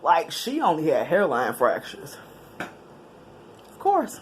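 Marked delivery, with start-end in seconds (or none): 2.6-4.2 whispered aside. Real, not acted. Wry aside on friend's luck.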